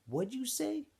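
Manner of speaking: put-on big camp bully squaring up to the new kid; bully's challenge. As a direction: push him, daring him to answer. Bully poking Stanley's neck.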